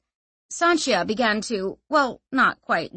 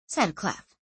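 ordinary delivery

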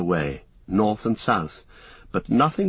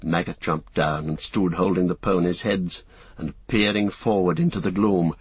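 usual speaking voice

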